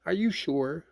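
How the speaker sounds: high-pitched surprised question. A narrator's reading. Rabbit doubting it is Pooh Bear, surprised. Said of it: "still more surprised".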